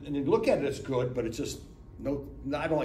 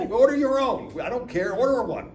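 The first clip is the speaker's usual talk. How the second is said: loud, higher mock scolding; annoyed telling-off; 8 semitones higher, 7 dB louder than usual. Wife telling husband to order his own, loud mock scold. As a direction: snap at him to get his own.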